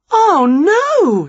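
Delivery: high, loud "oh no" of alarm; dismayed cry. A narrator's reading. Character reacts to house collapsing.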